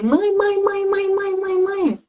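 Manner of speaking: put-on high, whiny kid repeating mom nonstop; child calling mom. Mother imitating her clingy child calling mom. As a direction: nag like a clingy little kid.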